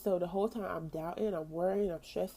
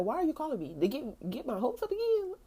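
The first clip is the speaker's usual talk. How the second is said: protest, rising higher to the last word; exasperated protest; 7 semitones higher, 3 dB louder than usual. Retold or imitated. Retelling her reaction to the lady's call, exasperated protest. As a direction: pitch climbing high into a mock whine.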